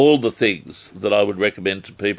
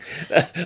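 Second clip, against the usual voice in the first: laugh; 8 semitones higher than usual. Real, not acted. Host laughs after joking.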